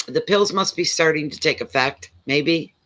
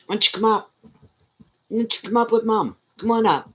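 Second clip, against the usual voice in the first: coaxing pet talk; 7 semitones higher, faster than usual. Real, not acted. Owner asking the dog to come up with Mom, coaxing pet talk. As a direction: coax the dog up in a high, sweet lilt.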